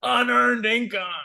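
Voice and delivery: slow high mock official; lightly hammed bureaucrat voice. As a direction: parody the worker's stiff verdict. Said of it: "they'll say".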